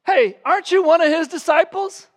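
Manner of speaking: high, loud accusing question; servant girl's accusation. Retold or imitated. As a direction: call him out sharply as the servant girl. Servant girl accusing Peter.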